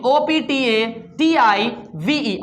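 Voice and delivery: O P T A T I V E, each letter said slowly and separately; spelled out letter by letter. Shown as a demonstration. Teacher spelling optative for the class, slow separate letters. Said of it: "fourth one is optative".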